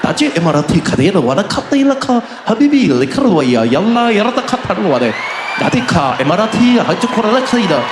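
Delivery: rolling, guttural mock-Arabic gibberish; mock Arabic announcement. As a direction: parody an Emirates safety video in made-up Arabic. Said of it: "And then the video comes on".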